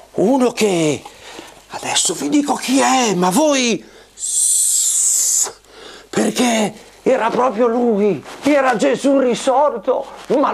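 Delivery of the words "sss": shushing. Acted. Hushing the children.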